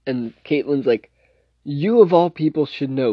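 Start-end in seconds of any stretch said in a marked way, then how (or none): 1.6-3.1 higher-pitched mock reproach; Caitlin, mildly angry. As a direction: imitate a cross scolding, only mildly angry. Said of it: "make her that angry".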